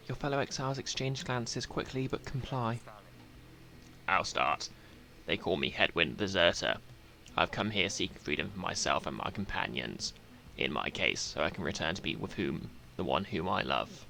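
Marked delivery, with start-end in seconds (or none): 4.1-14.0 low-pitched exiled deserter stating his purpose; Hedwyn. Acted. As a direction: drop well below your own voice for a sincere exile. Voices Hedwyn introducing himself in game.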